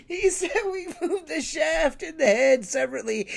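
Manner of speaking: steadier, still chuckling; retelling the line to listeners, amused. Real, not acted. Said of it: "I can't take anything you're saying seriously".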